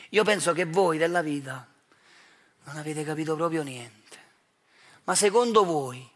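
normal talking voice

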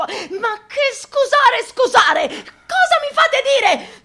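spluttering with indignation; outraged outburst. Acted. Priest catching himself mid-rant, outraged shriek.

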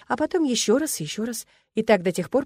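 plain reading voice

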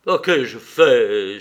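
loud, slow cry of dismay; remorseful cry. A narrator's reading. Levin sees Kitty weep, remorseful loud cry. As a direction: cry out, shocked at what he's done. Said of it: "s'écria-t-il aussitôt".

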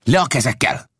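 put-on old man barking at the chief; sharp command. A narrator's reading. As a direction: snap the command. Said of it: "de az öreg rárivalt".

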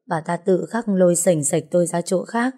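plain reading voice

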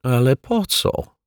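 slow, mumbled question; mumbling. A narrator's reading. Caretaker mumbling his baffled question, slowly. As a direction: slur it out, baffled. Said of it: "wybełkotał pan Mietek".

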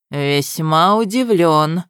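slow drawl, words mangled. Acted. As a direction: drag and twist the words. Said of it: "коверкая слова, протянул Ахмар". (медленно, коверкая слова)

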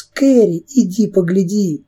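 высокий оклик, затем тише; позови её посмотреть; зовущий крик. A narrator's reading. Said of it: "кричал Чарльз". (оклик, зовёт посмотреть)

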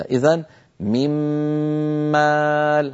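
من مال بغنة ممطوطة أكمل ما تكون; أطل غنة الميم المشددة. Shown as a demonstration. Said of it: "هكذا ننطقها ممطوطة الغنة". (ميم مشددة بغنة كاملة)

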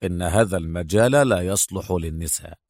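low, cool male officer voice; deep, dismissive warning; coldly. A narrator's reading. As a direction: lecture a rookie with deep, icy detachment. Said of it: "وقال ببرود".

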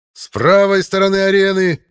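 high, loud market-barker cry; town crier. Acted. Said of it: "подал голос глашатай ... рыночного зазывалы". (крик базарного зазывалы)

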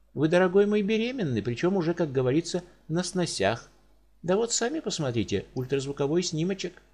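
high, bright bedside manner; doctor chirping the news to his patient, pitched high; cheery doctor. Acted. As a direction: break the odd news brightly, almost pleased. Doctor cheerfully announces pregnancy.

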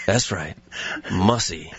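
low, lazy deadpan confirmation; terse tough guy, lazily in character; Steven Seagal impression. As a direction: stay dead serious and pitched low. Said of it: "your Steven Seagal impersonation".

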